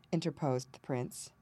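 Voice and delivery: plain reading voice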